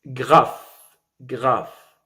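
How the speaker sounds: "graphe" isolated and slow; stressed last syllable. Shown as a demonstration. Teacher isolating the pronounced final syllable, "graphe" said separately. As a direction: say "graphe" alone, full vowel and sounded f. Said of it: "La dernière syllabe ici prononcée".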